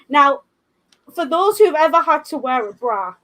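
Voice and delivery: ordinary talk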